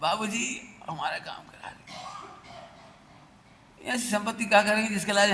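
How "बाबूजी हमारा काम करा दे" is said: whispered plea to a petty man; begging whisper. Retold or imitated. Rich man begging a lowly clerk for a favour, whispered. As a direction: beg in a hushed whisper. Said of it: "गिड़गिड़ा रहा".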